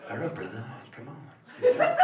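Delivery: a hint of [name] crooning; [name] impression. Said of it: "[name] is whispering in your ear".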